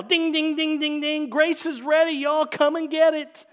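high, very loud porch holler; Southern dinner call. Acted. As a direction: call the whole family in to supper. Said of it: "He rings the dinner bell".

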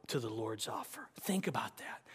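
whispered, intimate. Real, not acted. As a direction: lean in and whisper it. Whispered aside.

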